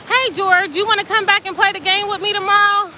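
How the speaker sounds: high, fast invitation voice; mock Steve. Steve invites George back to play, high cartoon voice. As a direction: chirp the invite like a cartoon kid.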